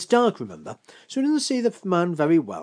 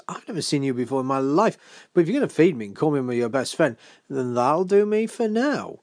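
fast, lively inner monologue; brisk, opportunistic thinking aloud; quick reasoning; 2 semitones higher, 5 dB louder than usual. Acted. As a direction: rattle off the bargain, lifting louder at the end. Paddy's private reasoning, voiced.

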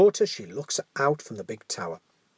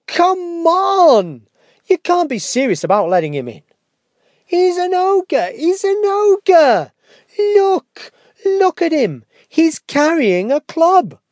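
high, loud panicked shout; shouting girl; 15 dB louder, slower than usual. Acted. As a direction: yell down from the tower. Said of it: "she shouts down from the window".

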